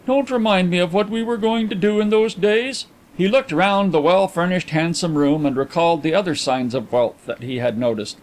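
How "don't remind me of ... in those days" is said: higher-pitched, bitter protest. A narrator's reading. Visitor refuses reminders of old plans, bitter. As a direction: fend off the memory bitterly. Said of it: "exclaimed Carlyle bitterly".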